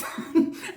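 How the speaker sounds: quick breathy laugh; amused unvoiced chuckle; short laugh. Real, not acted. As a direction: laugh at your own impression. Laughs after defiant quote.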